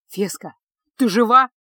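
loud call into darkness; calling out in the dark. A narrator's reading. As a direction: call out to find her. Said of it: "позвал маг в кромешной темноте". (громкий оклик в темноту)

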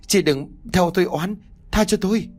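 desperate plea. A narrator's reading. Điệp begs ghost to spare her, high desperate plea. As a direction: plead at the peak of terror.

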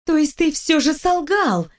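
gleeful snort. A narrator's reading. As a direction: pounce on it with mild glee. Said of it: "ликующе фыркнула я".

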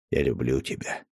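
soft, hushed declaration of love; whispered-soft I love you; tender. A narrator's reading. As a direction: say it low and close to her ear. Tender confession to his beloved.

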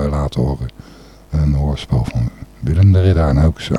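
ordinary delivery

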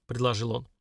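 plain reading voice

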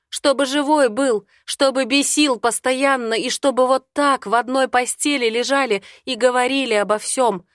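crying shout. Acted. Heroine sobbing and shouting that she needs him alive. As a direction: beg him to stay alive through tears. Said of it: "кричу, рыдая".

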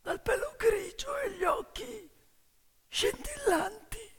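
lively once-upon-a-time telling; fairy tale telling. Acted. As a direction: spin the tale for the audience. Fairy tale told aloud.

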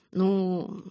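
long, hesitant drawl on the word; drawn-out. A narrator's reading. Boy put off by her smile, drawing it out. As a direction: stretch it out, discouraged. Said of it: "протянул Ньютон".

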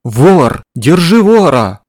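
loud, high, drawn-out bellow; mighty yell. Acted. Old woman yells out the window for help. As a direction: shout for the whole street to hear. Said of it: "возопила голосом поразительной мощи".

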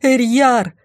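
high, urgent call of the name; shouting for someone. A narrator's reading. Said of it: "снова крикнула".

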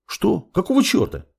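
high, taken-aback protest; startled protest. A narrator's reading. Apprentice grabbed by girlfriend, startled protest. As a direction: protest in high-pitched bewilderment.

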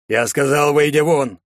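stern shouted dismissal; hammering each word. A narrator's reading. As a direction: throw him out, each word struck hard. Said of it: "чеканя слова".

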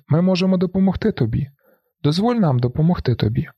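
high, urgent offer of help; pleading call. A narrator's reading. Support group pleading with the narrator, calling out. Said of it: "Вони кажуть".

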